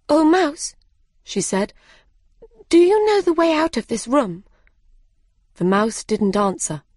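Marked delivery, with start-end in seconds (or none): 0.1-1.7 high, louder call for attention; high-pitched girlish appeal; calling out politely. A narrator's reading. Alice calls to the mouse. 2.7-4.4 high, louder, quick question; fast, high-pitched plea for directions; eager appeal. A narrator's reading. Alice asks the mouse for help.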